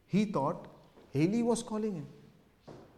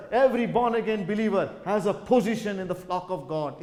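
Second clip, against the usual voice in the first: raised, insistent preacher's voice; forceful preaching; 5 semitones higher, 6 dB louder than usual. Acted. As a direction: drive each repeated phrase home.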